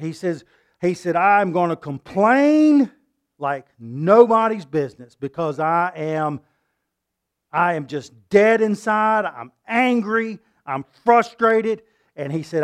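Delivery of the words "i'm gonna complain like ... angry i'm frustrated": slow anguished complaint. Acted. Voicing Job's anguish.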